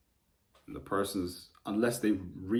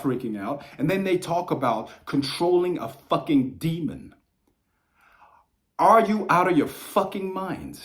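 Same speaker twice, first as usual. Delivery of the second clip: raised, higher furious rant at fake occultists; angry rant; 6 semitones higher, 4 dB louder than usual. Real, not acted. Ranting to viewers about weak occultists, higher and louder in anger. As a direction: tear into them, much higher and louder.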